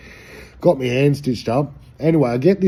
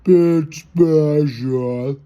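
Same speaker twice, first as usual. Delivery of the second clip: extremely slow, higher, rising; slower slow-mo; 4 semitones higher, 4 dB louder, much slower than usual. Shown as a demonstration. Repeating bit special to himself, even slower. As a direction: drag it out even more. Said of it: "like slow-mo".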